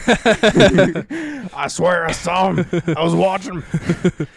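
loud, slow, high ranting insistence; hammed-up conspiracy-host growl; Alex Jones. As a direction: rant it like a conspiracy broadcaster. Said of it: "It sounds like an Alex Jones take".

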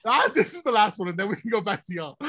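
ordinary delivery

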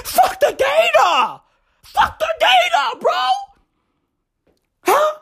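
squealed, very high strained shout; high-pitched screaming. Acted. Host raging to listeners about data, high-pitched screaming. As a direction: scream it way up high in comic outrage. Comic rant, screamed refrain.

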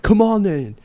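high, mock macho taunt; old driver daring the narrator to fight; mock tough challenge. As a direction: dare him to fight, hammed-up.

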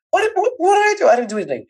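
high startled what what what; shocked protest. Retold or imitated. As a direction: squeak out a panicked denial. Startled teen caught by officer.

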